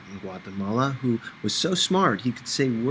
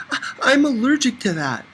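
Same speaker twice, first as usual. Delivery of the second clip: high, louder, stammering nervous excuse; flustered excuse; 14 semitones higher, 5 dB louder than usual. A narrator's reading. Narrator nervously tells Fanny an excuse. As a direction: blurt a panicked lie.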